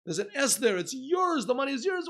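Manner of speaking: joyful shout of it's yours; excited discovery. Acted. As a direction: announce the money is his with delight. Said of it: "Wow, he's so happy".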